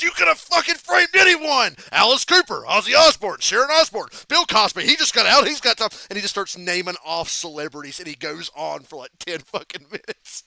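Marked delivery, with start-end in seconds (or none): 0.0-5.9 high, loud furious rant; furious yelling. Retold or imitated. Cena yelling at the man who framed his father, furious shout. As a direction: scream a list of names at him, furious. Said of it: "Cena's yelling at the guy".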